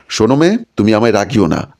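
plain reading voice

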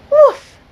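a defiant little dog's bark; high loud woof. Acted. The stubborn dog barks back at the narrator, high and loud.

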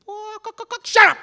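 high mock clucking taunt; chicken clucking. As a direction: cluck like a chicken, then snap. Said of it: "you chicken".

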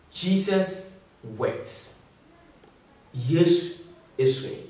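slow, soft, each word weighed; slow solemn reading. Shown as a demonstration. Preacher reading the shortest verse, slow and hushed. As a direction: let the two words fall heavily and slowly. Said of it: "The shortest quote in the Bible".

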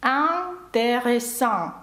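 very slow "Intéressant", smiling nasal IN, open nasal AN; two different nasals. Shown as a demonstration. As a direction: stretch "Intéressant" syllable by syllable, both nasals distinct. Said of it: "тут два різні носові звуки".

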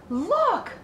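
put-on high, loud girl's cry of delight; excited cry. A narrator's reading. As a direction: call out thrilled, like a little girl. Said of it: "cried Fern".

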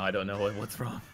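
usual speaking voice

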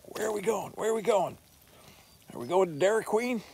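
high, eager doggy voice; voicing the excited dog. Thor the dog begging to go along, high eager voice. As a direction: pipe up like a dog who can't wait for a ride. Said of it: "He'd be like".

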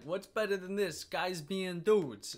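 put-on high, loud Vine quote in a New Jersey accent; New Jersey-style accent. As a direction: mimic a Jersey guy, stretching the last words. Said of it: "what this accent I'm doing here is".

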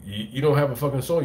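ordinary talk, nothing marked

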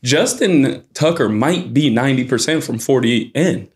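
normal talking voice

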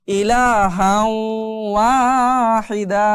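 slow recitation, "ইলাহাও" hummed into long "ওয়াহিদা"; merged ghunna, madd. Shown as a demonstration. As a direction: stretch the vowels and nasal w of "ইলাহাও ওয়াহিদা". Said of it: "মিলিয়ে পড়তে হবে".

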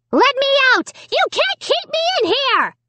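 very high, loud puppet rage; raging dummy. A narrator's reading. Locked in the closet, Slappy rages at Amy. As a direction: shriek it from behind the door. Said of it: "Slappy raged".

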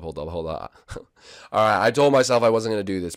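ordinary talk

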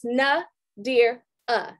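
"Na, dear, uh" split into three slow syllables; sounded out phonetically. Shown as a demonstration. Presenter teaching her audience her name, sounded out syllable by syllable. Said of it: "let me sound it out phonetically".

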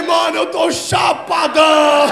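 high, very loud, drawn-out yell; hammed-up holler, stretching the end; shouted realisation. Comedian realises he is stoned, acted long shout.

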